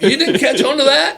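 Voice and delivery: high, loud, laughing disbelief; amazed disbelief. Retold or imitated. Coach teasing son who missed the trick, high laughing disbelief. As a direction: laugh it out, can't believe it. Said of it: "I'm like".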